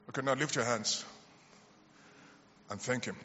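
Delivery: soft, low, fading murmur of prayer; hushed prayer. Acted. Pastor leading a silent healing moment, soft and low. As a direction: hush the room and lead thanks softly.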